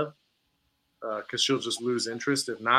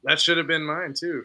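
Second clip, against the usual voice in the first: normal talking voice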